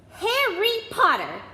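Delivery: very high, loud, slow outraged cry of his name; stern teacher's furious call; stern professor shout. A narrator's reading. Furious professor catching Harry, outraged shout of his name.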